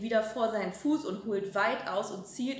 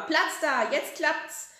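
eager; 7 semitones higher, 7 dB louder, slower than usual. Acted. Grandma eagerly playing football.

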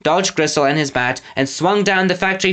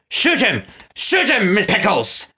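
bawled order; 6 semitones higher, slower than usual. Acted. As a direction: bawl the command at your henchman. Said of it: "Snatcher bawled".

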